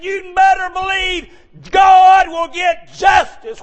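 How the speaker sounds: high, loud fired-up sermon cadence; preaching at full force. Acted. Preacher calling to the congregation, loud and fervent.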